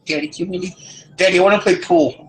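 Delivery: put-on high, whiny child voice; the daughter begging her dad, high and whiny; whiny little daughter. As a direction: whine like a bored kid at a bar. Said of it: "She's all mopey faced".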